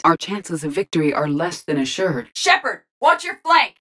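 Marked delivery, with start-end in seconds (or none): 2.3-3.8 high, sharp combat alert; urgent warning shout. Acted.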